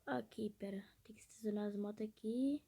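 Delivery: sussurro sem voz; sussurrando. Real, not acted. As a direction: fala só no ar. Mudança para fala baixa.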